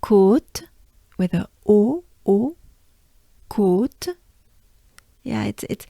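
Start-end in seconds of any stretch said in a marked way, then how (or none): 0.0-0.6 "Côte" with a long held O; sustained O. Shown as a demonstration. Teacher stretching the O of "Côte" for learners. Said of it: "it's a little sustained". 2.3-4.2 "Côte" with a long held O; sustained O. Shown as a demonstration. Teacher stretching the O of "Côte" for learners. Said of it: "it's a little sustained".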